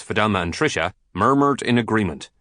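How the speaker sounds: plain reading voice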